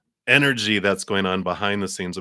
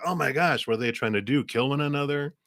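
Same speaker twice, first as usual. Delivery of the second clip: higher, acted shocked disbelief; mock alarm; 7 semitones higher than usual. Viewer watching a kung fu fight start, mock alarm. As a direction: exclaim like an aghast film viewer.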